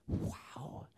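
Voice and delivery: breathless whispered wow; awed whisper. Real, not acted. Narrator overwhelmed by the light, whispering in awe. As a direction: let the wonder steal your breath.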